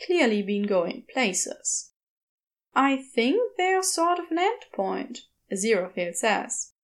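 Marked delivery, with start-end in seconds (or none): 2.7-5.2 Aziraphale's voice. A narrator's reading.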